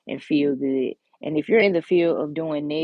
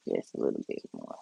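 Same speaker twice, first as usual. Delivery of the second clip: soft whisper under her breath; whispering to herself; 9 dB quieter, faster than usual. Real, not acted. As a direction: murmur it to yourself while concentrating. Whispering to herself while working.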